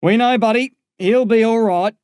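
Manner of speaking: high, loud voice of an officer in a hurry; ambulance officer's reassurance. A narrator's reading. Ambulance officer answering a child's call.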